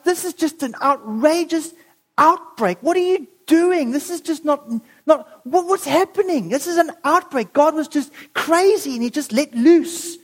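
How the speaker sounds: acted indignant protest with stumbling starts; angry and upset. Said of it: "And he's angry and upset".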